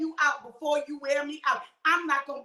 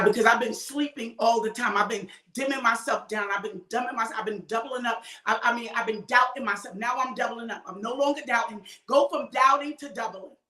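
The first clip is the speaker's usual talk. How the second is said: usual speaking voice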